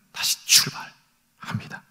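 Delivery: slow, breathy whisper. Real, not acted. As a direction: whisper it slowly. Preacher drops to a whisper. (천천히 속삭이듯)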